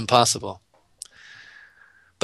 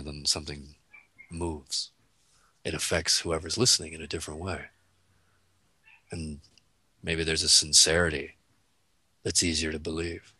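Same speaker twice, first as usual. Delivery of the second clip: low voice demo; 7 semitones lower, 9 dB quieter than usual. Shown as a demonstration. Showing a listener how a lower voice moves them, low and soft. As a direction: drop the pitch low and go much softer. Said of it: "if I talk down here".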